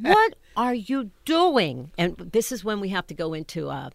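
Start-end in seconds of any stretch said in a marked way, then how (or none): 0.0-1.9 slow, high, loud imitated question; mock incredulous. Co-star asking the actress on stage, mock incredulous. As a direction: draw out each word in mock disbelief.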